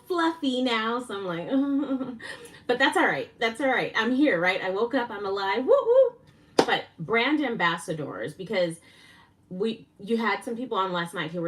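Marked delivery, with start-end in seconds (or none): 5.6-6.1 high, loud whoop of joy; a real, joyful woo-woo; excited cheer. Real, not acted. Cheering for being alive.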